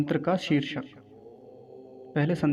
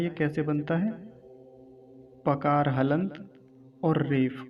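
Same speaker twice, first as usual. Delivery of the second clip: usual speaking voice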